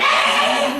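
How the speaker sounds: loud, menacing "Ah!"; mock spirit scream. Teacher playing bad spirits to a student, screaming. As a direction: shriek like an evil spirit attacking. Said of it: "No, they're going".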